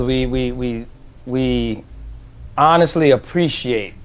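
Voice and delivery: stumbling over the words, real; repeating words, lost for words; stuttering. Real, not acted. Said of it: "you see how I'm stuttering".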